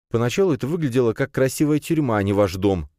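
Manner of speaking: put-on young man's mild protest; grumbled. A narrator's reading. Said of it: "буркнул Фирс". (ворчливо)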